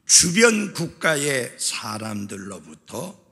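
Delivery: ordinary delivery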